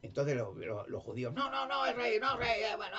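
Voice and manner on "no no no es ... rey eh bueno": much higher shouted protest; crowd shouting. Retold or imitated. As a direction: yell the objection high. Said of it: "siempre gritando".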